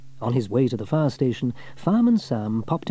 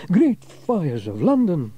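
slow startled exclamation; exclaiming; 6 semitones higher, 5 dB louder, slower than usual. A narrator's reading. Sam dodging the racing bicycles, exclaiming. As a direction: jump back in shock. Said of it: "Fireman Sam exclaimed".

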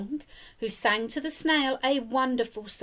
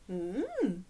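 plain reading voice

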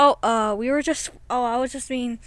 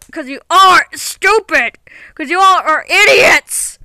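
angry yell; 2 semitones higher, 10 dB louder than usual. Acted. Toy insults the others.

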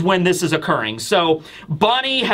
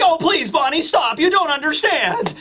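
put-on much higher, begging old scientist; pleading Vegapunk; 9 semitones higher than usual. Scientist begging the girl who wants to kill him, higher pleading voice. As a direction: plead with her to stop, lightly voiced. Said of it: "Vegapunk was like".

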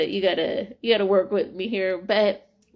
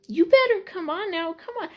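fervent praise; 11 semitones higher, 3 dB louder than usual. Real, not acted. Praising God for the miracle.

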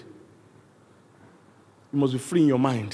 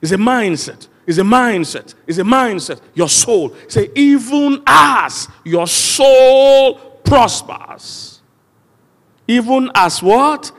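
higher, louder, drawn-out sermon cry; forceful preaching; 8 semitones higher, 7 dB louder, much slower than usual. Real, not acted. Preacher driving a verse into the congregation, forceful preaching. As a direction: preach it hard, stretching the key words.

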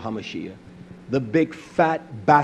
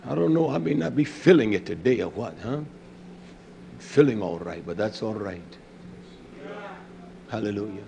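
low and subdued; 6 semitones lower, 7 dB quieter than usual. Real, not acted. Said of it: "why I'm talking like this".

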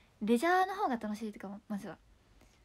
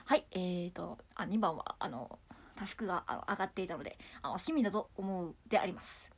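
lower, stiff politician voice imitated; mimicked stiff political speech, lower pitch; Abe impression; 6 semitones lower, 5 dB quieter than usual. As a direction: mimic a prime minister's formal answer. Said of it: "元安倍総理さんのモノマネ".

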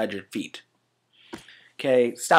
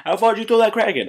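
excited interviewer; 11 semitones higher, 6 dB louder, faster than usual. Acted. Voiced interviewer question.

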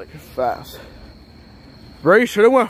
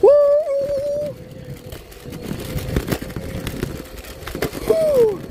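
long high whoop on the ride; sledding downhill. Real, not acted. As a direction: whoop while rushing downhill. Round two ride down.

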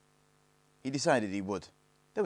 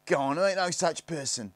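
high, loud disbelief; scoffing; 9 semitones higher, 7 dB louder than usual. A narrator's reading. Second Snarget disputes Stig's existence, scoffing high voice. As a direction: dismiss it loudly, pitched up.

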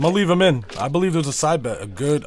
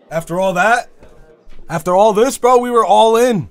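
rising louder and higher; building frustration; 6 semitones higher, 7 dB louder than usual. Real, not acted. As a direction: work up into an outraged rant. Frustrated rant about the dealer change.

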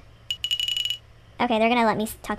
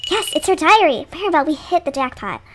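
high, loud burst easing off; excited discovery; 4 semitones higher, 4 dB louder than usual. Acted. Character telling Maribel of the diary find, excitedly. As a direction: exclaim with delight, starting high and loud.

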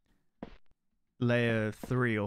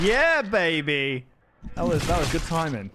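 triumphant cheer; 3 semitones higher, 4 dB louder than usual. Real, not acted. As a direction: savor the win out loud. Celebrates in-game success.

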